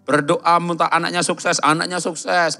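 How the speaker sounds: loud rhythmic pulpit delivery; full-force preaching. Acted. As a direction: drive each phrase home.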